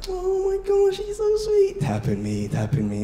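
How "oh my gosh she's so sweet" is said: much higher, gushing parents' coo; high gushing aww from the crowd; cooing onlookers. Retold or imitated. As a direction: coo over the crying toddler like a fond onlooker. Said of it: "Everyone around is like".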